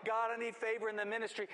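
high, insistent mock plea to God; hammed-up, urgent begging in prayer; pleading prayer. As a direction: cry out needs one after another. Acted desperate prayer to God.

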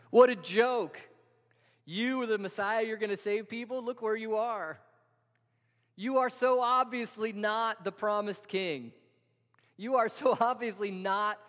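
high-pitched taunting ridicule; mocking jeer. Passers-by mocking Jesus on the cross, high scornful jeer. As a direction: rub it in, pitched well above normal talk. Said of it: "Rubbing it in, mocking him".

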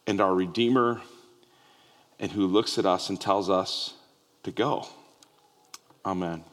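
prayer. Acted. Pastor leading the congregation in prayer, hushed and reverent. Opening prayer before sermon.

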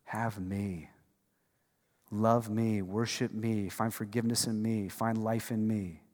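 soft, low and tender invitation; gentle voice of God. Retold or imitated. God inviting his people to himself, soft tender call. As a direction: offer it quietly, like a held hand. Preacher voicing God's invitation.

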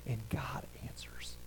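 sudden hushed whisper. Real, not acted. Preacher whispering to the congregation, hushed. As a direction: drop to a whisper for God's answer.

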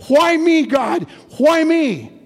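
high, loud anguished cry; crying out to God. Acted. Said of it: "he began to cry out".

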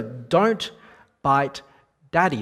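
put-on stern parent scolding a biting child. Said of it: "I raised my voice at her".

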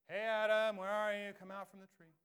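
loud call across a garden. Acted. God calling out loud for Adam hiding in the garden. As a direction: shout out for someone hiding.

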